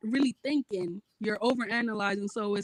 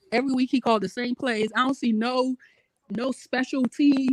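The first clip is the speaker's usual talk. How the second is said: usual speaking voice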